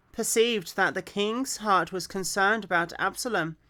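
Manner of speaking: clear, projected story reading; scripture read-aloud. Acted. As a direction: read the Bible story out with expression.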